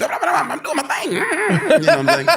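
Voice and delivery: high, slow comic riffing voice; Dave Chappelle. Retold or imitated. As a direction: stretch it out high, hamming up a riffing comedian. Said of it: "and Dave just".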